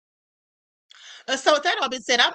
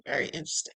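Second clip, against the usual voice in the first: whispered aside; 9 semitones lower, 9 dB quieter, faster than usual. Shown as a demonstration. Vlogger musing to her viewers in a whispered aside. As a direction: whisper "Very interesting" low and soft.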